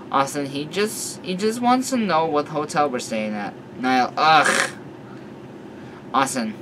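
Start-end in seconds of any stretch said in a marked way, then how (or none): none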